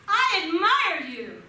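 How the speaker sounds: high, loud, drawn-out call; screamed praise. Acted. Dad showing his son the echo, screamed praise. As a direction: shout it slowly out to the mountain. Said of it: "Then the dad screams".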